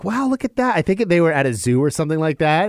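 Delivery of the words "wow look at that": high, bright mock parent voice; excited adult showing a child. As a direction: parents point things out at the zoo, high mock voice. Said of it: "they're like".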